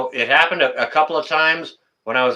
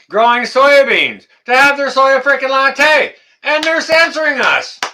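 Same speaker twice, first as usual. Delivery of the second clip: louder and higher, real indignation; indignant outburst; 9 semitones higher, 6 dB louder than usual. Real, not acted. To the chat about soy lattes, an indignant louder higher rant. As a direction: let the anger about censorship burst out.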